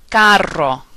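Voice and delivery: Spanish "Carro" with a trilled RR; rolled R. Shown as a demonstration. Teacher giving words to practice, rolled R in "Carro". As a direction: roll the R in "Carro", as "KAH-rro". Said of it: "the rolling R sound".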